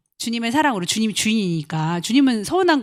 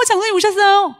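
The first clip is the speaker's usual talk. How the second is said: high, bright welcoming greeting; mock sugary welcome; cheery greeting; 11 semitones higher, 4 dB louder than usual. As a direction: fawn over the elder. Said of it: "싹 한 명 지나가고".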